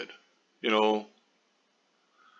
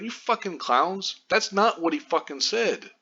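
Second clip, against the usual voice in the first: higher, scornful jab at the press; fed-up scorn; 8 semitones higher than usual. Real, not acted. Vlogger scolding news outlets, higher scornful jab. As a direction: spit it at them, pitch up. Ranting at misleading news headlines.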